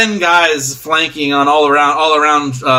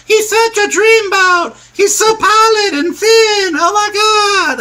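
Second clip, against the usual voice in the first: very high, drawn-out falsetto gushing; swooning fangirls; 3 dB louder, slower than usual. Acted. Fangirls swooning at the back, mock shrill squealing. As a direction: squeal like a lovestruck fan, hammed up. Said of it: "you hear some fangirls in the back".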